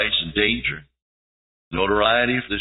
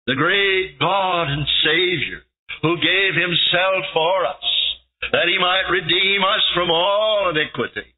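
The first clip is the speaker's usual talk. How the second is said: preaching; 6 semitones higher than usual. Acted. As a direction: proclaim the scripture higher. Preacher raises voice for scripture.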